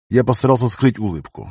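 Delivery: ordinary delivery, nothing marked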